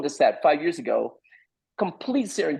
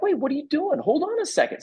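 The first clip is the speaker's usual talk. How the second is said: much higher, acted-out "wait a second"; alarmed interruption; 12 semitones higher than usual. Teller cuts in on frantic writer, alarmed. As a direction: break in, pitched way up.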